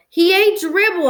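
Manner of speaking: high, loud, drawn-out protest; insistent. A narrator's reading. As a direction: insist on it to a doubting mother. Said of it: "I insisted".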